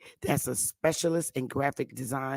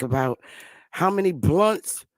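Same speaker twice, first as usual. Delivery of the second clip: ordinary delivery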